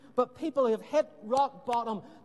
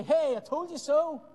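higher, louder cheeky taunt; mock gloating; 6 semitones higher, 4 dB louder than usual. Jesus imagined taunting Pilate, mock gloating and higher. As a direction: toss it off like a smug told-you-so. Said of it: "go into Pilate and say".

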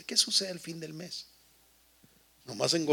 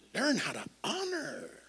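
high slow line; 12 semitones higher, 5 dB quieter, slower than usual. Real, not acted. Sermon climax.

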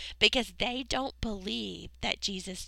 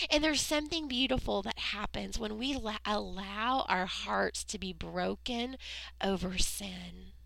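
usual speaking voice

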